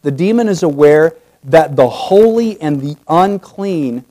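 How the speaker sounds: usual speaking voice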